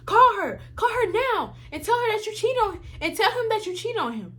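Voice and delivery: high, barked order, half-mocking the sergeant; mock drill sergeant order. Drill sergeant ordering the recruit to call her husband, mock bark. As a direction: bark it like an impatient drill instructor. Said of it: "drill sergeant was like".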